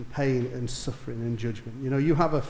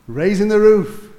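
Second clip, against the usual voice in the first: slow, louder cry of triumph; loud exclaim; 6 semitones higher, 10 dB louder than usual. Real, not acted. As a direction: lift it like a roaring stadium. Crowd cheering in the story.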